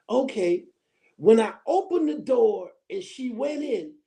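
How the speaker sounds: usual speaking voice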